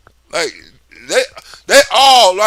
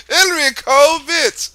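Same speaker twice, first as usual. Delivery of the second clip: mocking call; 6 semitones higher than usual. Mocking chant at Hillary.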